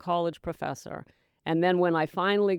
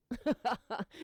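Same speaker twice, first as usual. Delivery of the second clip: laugh; 4 semitones higher, 8 dB quieter than usual. Real, not acted. Laughs at her own joke.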